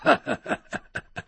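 quiet voiceless snicker; soft acted chuckling; stifled laugh. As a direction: snicker as if barely holding it in. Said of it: "差点没笑喽".

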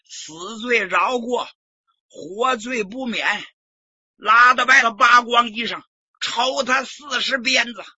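vindictive shout. Acted. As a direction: spit out the punishment. Li Yuanji demanding a flogging. (李元吉恶狠狠地喊)

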